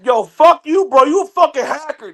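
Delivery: high, loud imitation of an outraged player; mock furious gamer. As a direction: play the enraged opponent yelling. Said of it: "motherfucker will be like".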